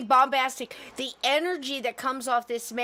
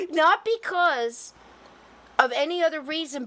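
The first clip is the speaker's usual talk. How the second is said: laughing; 5 semitones higher, 4 dB louder than usual. Real, not acted. Still laughing at the name, telling the host. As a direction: still laughing, push the words out.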